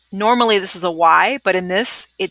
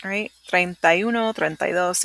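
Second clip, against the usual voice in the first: ordinary delivery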